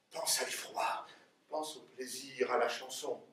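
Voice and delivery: conjure each thought in a hush; hushed evocation. Acted. Father teaches daughter to think each feeling, hushed and low.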